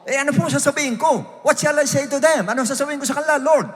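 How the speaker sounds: high-pitched imitated asking of God; acting out an anxious question to the Lord; Moses. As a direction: pitch it up, questioning, like a puzzled servant. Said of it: "Yun ang sabi niya sa Lord mismo".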